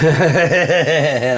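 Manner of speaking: drawn-out words, laughing loudly; laughing shout. Real, not acted. Boasting to viewers, slow laughing shout.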